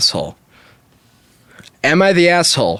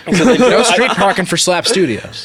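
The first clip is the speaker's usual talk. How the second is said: laughing; 5 semitones higher, slower than usual. Real, not acted. Joking about the fancy garage.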